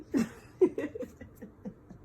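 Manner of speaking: breathy amused giggle; kind gentle laugh. Real, not acted. Laughs after teasing.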